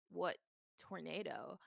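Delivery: low, slow baffled reply; deadpan disbelief. Retold or imitated. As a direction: act out a slow, puzzled question. Acting out her puzzled reply.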